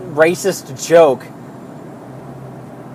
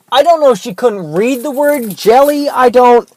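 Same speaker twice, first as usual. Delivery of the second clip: higher, louder disbelief; baffled exasperation; 6 semitones higher, 5 dB louder than usual. Real, not acted. Worker baffled by customer who pointed at jelly, exasperated. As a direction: rise high and loud, at a loss.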